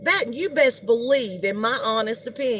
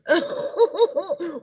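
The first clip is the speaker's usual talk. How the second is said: short amused laugh; 6 semitones higher than usual. Real, not acted. Laughing at a chat comment.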